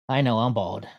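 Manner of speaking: ordinary delivery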